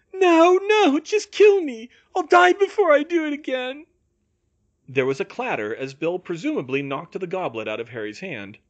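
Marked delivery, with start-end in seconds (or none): 0.1-3.9 high, loud, anguished cry; desperate refusal. A narrator's reading. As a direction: refuse frantically at a high pitch. Bill begging to be killed.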